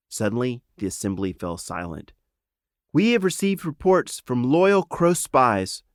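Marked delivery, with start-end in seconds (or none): 2.9-5.8 slow, louder, higher proclamation; announcing. Acted. Princess announces to the gathered animals, slow and formal. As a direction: address the assembly formally. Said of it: "Gamella announced".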